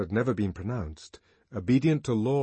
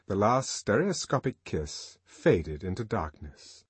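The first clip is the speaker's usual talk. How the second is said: ordinary delivery, nothing marked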